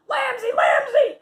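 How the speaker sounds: high, slow, loud call. Retold or imitated. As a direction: shout the name across the car. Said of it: "she's like".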